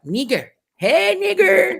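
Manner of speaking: put-on high, loud kid greeting; imitating the kids hollering it; mocking kids. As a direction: call it out like a playful kid. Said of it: "these Latin kids is like".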